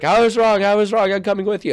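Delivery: high, loud mock panic; panicked backtracking. Retelling a film scene of a man running back, mock panic. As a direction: blurt it out in a mock-frantic high voice.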